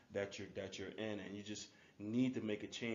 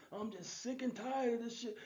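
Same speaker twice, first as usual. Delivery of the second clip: pitched way up, a contained retold holler; movie-character yell; 12 semitones higher than usual. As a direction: imitate a fed-up man yelling out a window. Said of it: "the guy yells out the window".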